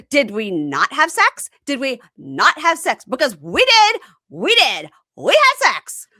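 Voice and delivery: high, loud hammed-up shouting; mock shrill yelling. Imitating the girlfriend shouting after him, high and loud. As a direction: yell it at his back, rising.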